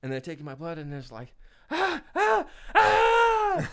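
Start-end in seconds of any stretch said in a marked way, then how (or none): 1.7-3.7 high, loud yelps building to a scream; hammed-up high-pitched screaming; acted-out screams. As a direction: shriek in mock pain, two short then one long. Acting out blood being taken.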